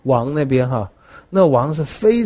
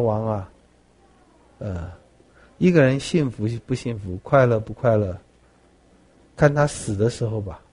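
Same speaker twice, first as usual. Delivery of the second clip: low, soft and measured; calm sage's reply; 5 semitones lower, 5 dB quieter than usual. Retold or imitated. Solon quietly warning the king, low and soft. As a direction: answer the proud king with quiet wisdom.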